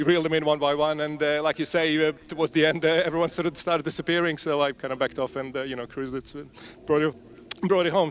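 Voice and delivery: breathless, gasping for air between words; puffing after the race; out of breath. Real, not acted. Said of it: "Sorry, I'm out of breath".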